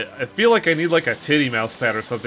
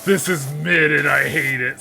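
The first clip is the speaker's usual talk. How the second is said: quoting a friend; 3 dB louder than usual. Retold or imitated. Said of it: "says Tiger".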